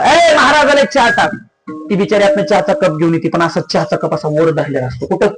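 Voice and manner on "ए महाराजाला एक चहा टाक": raised-pitch acted call; calling the wife. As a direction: call to your wife for tea. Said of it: "बायकोला हाक मारता". (बायकोला मारलेली हाक)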